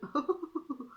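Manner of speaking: high-pitched giggle. Real, not acted. Pleased with her new bottle, high giggle. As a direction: giggle in quick high bursts. Giggles over buying two.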